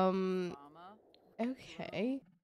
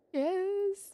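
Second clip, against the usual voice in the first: excited cheer; 11 semitones higher than usual. Real, not acted. Game notice, cheering.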